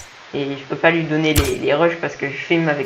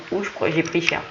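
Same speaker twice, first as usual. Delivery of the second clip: usual speaking voice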